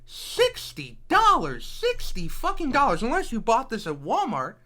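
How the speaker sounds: heated rant. Real, not acted. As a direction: scold the buyers hard. Heated rant about price.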